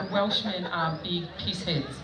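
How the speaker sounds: ordinary talk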